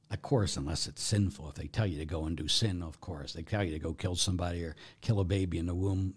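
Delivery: ordinary talk, nothing marked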